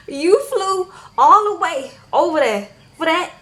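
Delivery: can't believe he flew there for that; loud, high, disbelieving; incredulous. Real, not acted. Incredulous aside to David.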